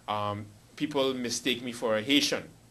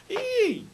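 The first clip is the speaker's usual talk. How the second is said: very high squealed "eee"; party whoop. Real, not acted. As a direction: let out a long high salsa squeal. Whoops while starting salsa dance.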